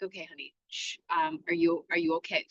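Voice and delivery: mother calming her bitten daughter, soft and low; calm reassuring mom. Retold or imitated. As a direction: settle her down tenderly. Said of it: "Okay, stop the fear".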